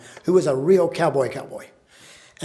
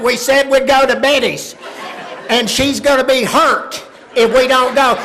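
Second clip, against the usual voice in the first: loud, high protest; insistent mother; 8 semitones higher, 6 dB louder than usual. Retold or imitated. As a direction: plead the case stubbornly at the husband.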